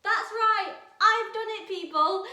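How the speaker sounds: loud, high cheer of victory; triumphant cheer. Real, not acted. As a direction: crow it out like you just won. Triumphant teacher opening.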